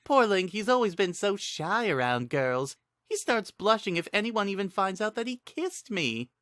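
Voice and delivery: high, playful teasing voice; teasing young woman. Acted. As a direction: tease him in a sweet, high voice. Sing teases him to a stranger.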